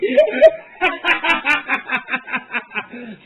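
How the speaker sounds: loud laughing fit. Real, not acted. Laughing hard at Liam.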